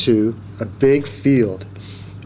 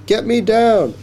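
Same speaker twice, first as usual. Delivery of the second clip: high-pitched urgent demand; alarmed plea; 10 semitones higher, 3 dB louder than usual. A narrator's reading. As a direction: plead loudly to be rescued. Frightened reporter up by giant.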